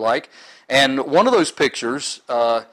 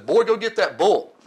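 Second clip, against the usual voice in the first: lightly voiced father, higher pitched; raised-pitch mock fatherly order; father's command; 9 semitones higher than usual. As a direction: order the boy off, lightly acted. Father's order, acted in story.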